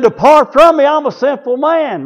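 high-pitched acted plea; pleading outcry. Peter confessing to his Lord, high pleading cry.

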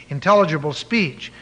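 usual speaking voice

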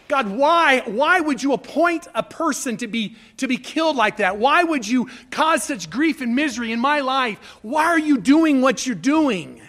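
loud questioning cry to God; a preacher voicing his grief to God; anguished lament. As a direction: press God for an answer.

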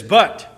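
BUT punched out loud; loud, forceful BUT; very emphatic. Acted. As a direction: hit BUT hard, as a sudden halt. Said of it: "This is a very emphatic word".

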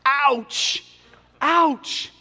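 high, playful yelp of ouch; mock pained exclamation. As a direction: yelp as if the point stung. Preacher reacts as if stung.

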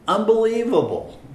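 amazed. Acted. Preacher marvels at Jesus washing Peter's feet, astonished. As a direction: burst out in disbelief.

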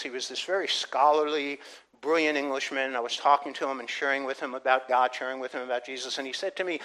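normal talking voice

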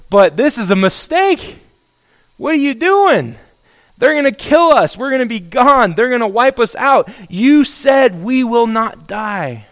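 high, loud, urgent pleading; alarmed protest. Acted. Prophet telling God they will be killed, panicked protest. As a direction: plead with rising alarm, stretching the final accusation.

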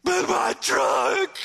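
put-on high, slow child's shout; mock kid's stubborn wail; wailing little boy. Boy ignoring the fortune, wailing for his truck. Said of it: "The seven-year-old looks up and goes".

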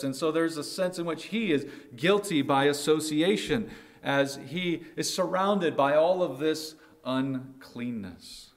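ordinary talk, nothing marked

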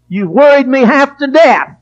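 ordinary talk

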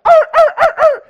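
loud, high-pitched dog barks; dog yelping. Acted. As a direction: yelp like a dog hit by a rock. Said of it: "eventually you're gonna hear".

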